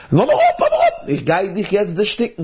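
very high, excited animal threat; pouncing fox. Acted.

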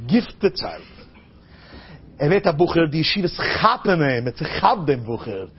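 ordinary talk

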